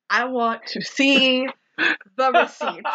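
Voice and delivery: I want to see... the receipts, each part drawn out and spaced; slow down and lean on "see" and "receipts"; slow emphatic repeat. Acted. Repeats slower, separated.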